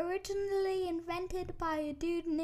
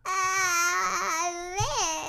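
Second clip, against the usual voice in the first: whiny put-on annoying voice; 2 semitones higher, 3 dB louder than usual. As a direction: grate on everyone's nerves on purpose. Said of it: "why do you always go to the annoying voice".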